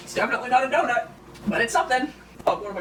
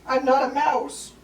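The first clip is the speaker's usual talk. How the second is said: slow mock indignation; 5 semitones higher, 4 dB louder, much slower than usual.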